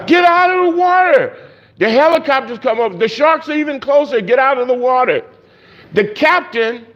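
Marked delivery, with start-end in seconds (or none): 0.1-1.3 frantic yell. Acted. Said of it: "So they're yelling at him". 4.2-5.2 high urgent call, less force; yelling. Acted. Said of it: "So they're yelling at him".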